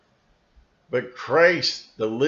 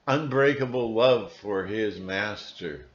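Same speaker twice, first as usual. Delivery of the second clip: normal talking voice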